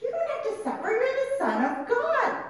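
high-pitched, stretched mock persuasion; acted coaxing protest. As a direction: talk him out of it, hammed up. Acts the devil's tempting argument.

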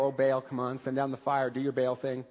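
low, quiet, half-hearted plea; mock a droning prayer, low and soft. Mocks Baal worshippers' all-day prayer.

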